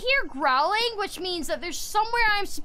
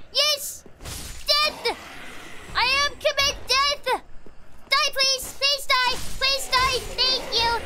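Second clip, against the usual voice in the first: high, gleeful combat yelling; high-pitched manic excitement; frantic battle cries; 7 semitones higher, slower than usual. Real, not acted. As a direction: squeal demands at the enemy as you fight.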